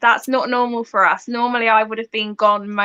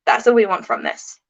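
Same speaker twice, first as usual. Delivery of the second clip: ordinary delivery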